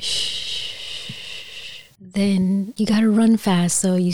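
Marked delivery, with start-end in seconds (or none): 0.0-0.7 long, soft hushing wind noise; wind sound effect. Shown as a demonstration. Imitating wind from the interactive record, soft hushing. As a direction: blow a sustained shushing whoosh. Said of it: "the sound effects were like".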